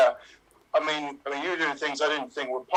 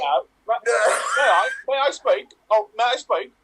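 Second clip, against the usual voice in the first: excited raised voice; 5 semitones higher, 5 dB louder than usual. Acted. Improv scene, raised voice.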